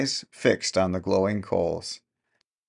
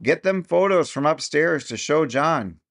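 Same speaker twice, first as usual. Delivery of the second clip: bawled; 6 semitones higher than usual. A narrator's reading. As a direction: bawl it across the room. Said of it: "he bawled".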